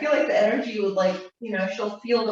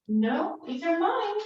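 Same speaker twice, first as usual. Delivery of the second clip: high playful protest; playful claiming; 8 semitones higher than usual. Real, not acted. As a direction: lilt it upward as if to a pet. Playfully claims the balls from dog.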